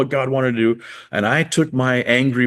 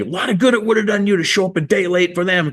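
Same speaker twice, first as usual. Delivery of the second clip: much higher, accusing retort; mock indignant retort; 9 semitones higher than usual. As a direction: throw it back at God, pitched high. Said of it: "And so I'm saying".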